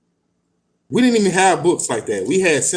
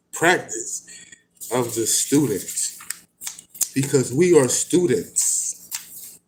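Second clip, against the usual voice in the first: measured, deliberate book reading; reading aloud. Acted. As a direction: read it out like scripture. Reads passage from a book.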